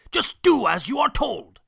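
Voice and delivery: loud, high angry snap; barked order. Acted. Circus boss barking orders at the captain. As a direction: bark it at the captain. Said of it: "barked Mister Payne".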